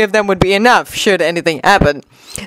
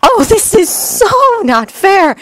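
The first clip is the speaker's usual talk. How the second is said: high, drawn-out whine; slow, pitched-up grumble of protest; complaining; 10 semitones higher, slower than usual. Acted. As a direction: complain that it's unfair. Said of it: "Temari complained".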